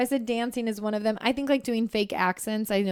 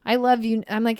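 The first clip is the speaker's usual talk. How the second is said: ordinary talk, nothing marked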